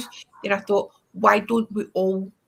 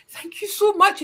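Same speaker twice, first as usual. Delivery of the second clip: higher, louder gushing thank you; overjoyed thanks; 11 semitones higher, 4 dB louder than usual. Retold or imitated. Relieved tourist thanks the stranger, gushing and higher. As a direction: gush with grateful relief. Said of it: "I have never been so grateful".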